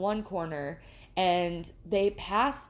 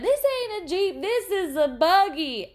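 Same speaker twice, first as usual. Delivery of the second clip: much higher, louder mock correction; sassy local correction; 10 semitones higher, 6 dB louder than usual. Waitress retelling the car joke, high sassy mock voice. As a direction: set him straight like a backroads local. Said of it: "And she said".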